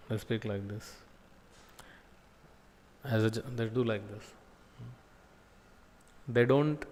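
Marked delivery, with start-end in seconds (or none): 0.1-0.9 very soft, hand over the mouth; humble hushed speech. Shown as a demonstration. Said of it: "they put the hand like this and speak". 3.0-4.2 humble hushed speech. Shown as a demonstration. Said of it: "they put the hand like this and speak".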